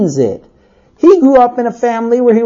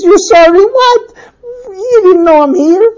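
high, indignant questions; incredulous husband; 11 semitones higher, 3 dB louder than usual. Acted. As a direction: fire back in high-pitched disbelief.

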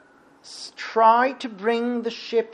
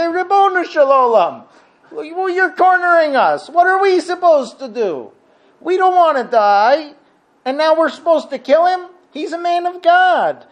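very high, loud, anguished appeal; desperate prayer; 8 semitones higher, 5 dB louder than usual. Retold or imitated. Cornered sailors pleading with God not to force them, desperate. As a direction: cry out to heaven, stammering as you beg.

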